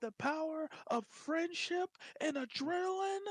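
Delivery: heroic shout. Retold or imitated. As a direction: declare it like an anime hero. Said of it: "He's all like".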